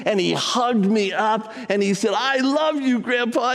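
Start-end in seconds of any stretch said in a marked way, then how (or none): 2.1-3.5 put-on high, sweet child's voice; little boy voice. Grandson hugging grandpa, high child voice. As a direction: speak as the small grandson hugging him.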